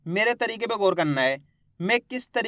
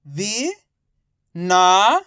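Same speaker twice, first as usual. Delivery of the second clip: direct syllable reading; 2 semitones lower, much slower than usual. Shown as a demonstration. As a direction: sound out वि, ना, श with pauses. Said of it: "direct मात्रा का उच्चारण करके पढ़ेंगे". (वि, ना, श को अलग-अलग रुककर पढ़ें)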